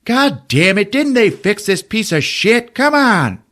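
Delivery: annoyed worker at the conveyor; irritated worker. Retold or imitated. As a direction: curse the broken machine. Worker angry at machine.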